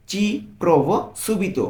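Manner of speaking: very slow, every syllable laid out; slow model phrase. Shown as a demonstration. Teacher models the Italian phrase for a learner, very slowly. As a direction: read it out slowly so a learner can copy. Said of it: "পুরোটা একসাথে কি বলতে পারি?".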